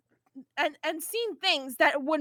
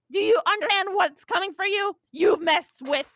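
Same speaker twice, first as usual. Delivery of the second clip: higher, louder, ends in a shout; furious threat; 5 semitones higher, 5 dB louder than usual. Acted. Vriska ending her broadcast with a shouted threat at the planet. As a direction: build to a roaring threat.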